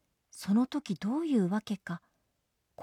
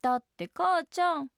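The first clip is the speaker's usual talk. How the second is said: put-on high child voice, protesting; jellyfish child; 9 semitones higher than usual. A narrator's reading. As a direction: plead with mother in a small high voice. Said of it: "クラゲの子の声がしました".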